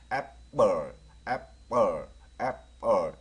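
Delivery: 애플 with the 플 swallowed into a dark l; 애플 in two beats, second syllable swallowed; slightly swallowed. Shown as a demonstration. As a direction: swallow the end of 애플 a little. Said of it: "약간 먹어줘야 됩니다".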